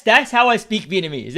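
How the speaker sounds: ordinary talk, nothing marked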